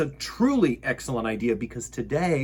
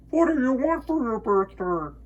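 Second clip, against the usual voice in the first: echo; 11 semitones higher than usual. Acted. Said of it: "his own voice echoed off".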